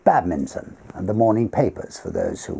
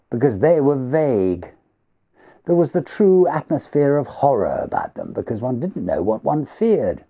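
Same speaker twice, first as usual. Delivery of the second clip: lightly voiced host, higher, with a hint of eerie earnestness; Everard's voice; 7 semitones higher than usual. Host answering his guest about real nightmares, lightly higher and earnest. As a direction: let him warm to childhood nightmares.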